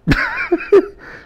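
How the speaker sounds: sudden very high squeal of laughter; high helpless giggling; giggle fit. Real, not acted. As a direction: burst into giggles mid-sentence. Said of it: "Let's get the giggles out".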